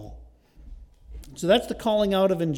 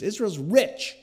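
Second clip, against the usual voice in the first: normal talking voice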